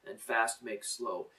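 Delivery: drawn-out saying; slow saying. Retold or imitated. Teacher quoting his first teacher, slow. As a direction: let each word land slowly. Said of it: "favorite saying of mine".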